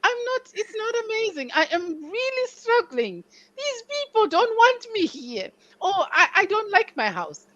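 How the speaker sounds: high, whining self-pity; whiny complaining. Retold or imitated. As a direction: plead it like a homesick complainer. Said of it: "And they're like".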